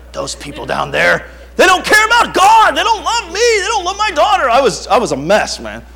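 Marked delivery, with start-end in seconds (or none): 0.1-4.5 loud, high-pitched mock tirade; building into a high, shouted complaint; hammed-up outraged rant. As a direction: rail like an aggrieved, overprotective dad. Acts out his rant about Bible college.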